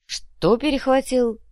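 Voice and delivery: high, slow amazed question. A narrator's reading. Kumar puzzles over the odd word, amazed. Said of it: "изумился Кумар".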